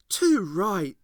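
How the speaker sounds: loud, sharp cut-in; loud interjection. A narrator's reading. Ace cutting into the teacher's lecture, loud. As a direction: blurt it out over the teacher. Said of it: "punctuated Ace loudly".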